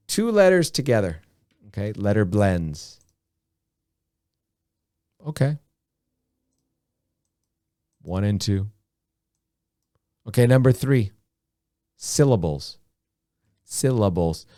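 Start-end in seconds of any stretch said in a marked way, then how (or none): none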